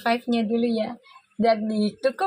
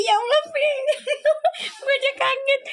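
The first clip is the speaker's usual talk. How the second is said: giddy and amazed; high excited squeal; 13 semitones higher, faster than usual. Real, not acted. Streamer squealing to Puji about the cards, high and giddy. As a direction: squeal her name, pitched way up. Reacting to Puji's reading.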